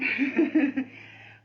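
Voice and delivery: loud burst of laughter; real laugh. Real, not acted. Teacher amused by the super hot room, loud laugh. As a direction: break into a hearty laugh.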